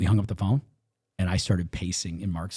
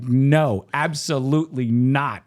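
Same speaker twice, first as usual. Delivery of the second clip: loud, higher, punched-out words; retold emphatic refusal; 5 semitones higher, 6 dB louder, slower than usual. Wife rejecting husband's news, loud emphatic refusal. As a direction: refuse forcefully, each no separate.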